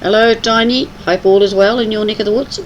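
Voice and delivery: ordinary talk, nothing marked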